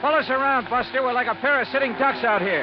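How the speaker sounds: desperate yell to the partner at the tiller; alarmed shouting. Acted. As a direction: shout to swing the boat away from the gunfire. Under fire, shouting at partner.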